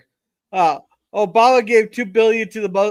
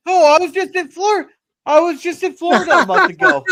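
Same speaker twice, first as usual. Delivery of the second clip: bursting with excitement; excited; 7 semitones higher than usual. Real, not acted. Excited about Florida.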